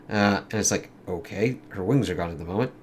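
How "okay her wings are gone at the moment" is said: ordinary talk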